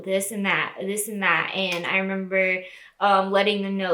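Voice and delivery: ordinary talk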